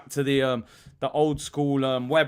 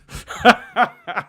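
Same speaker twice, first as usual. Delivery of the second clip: short bursting laugh; 4 semitones higher, 5 dB louder than usual. Real, not acted. Streamer laughing at his mum skit. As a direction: laugh at your own skit.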